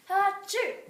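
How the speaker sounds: big high sneeze; loud sneeze. Acted. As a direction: sneeze it out fully. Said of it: "sneezed the young girl".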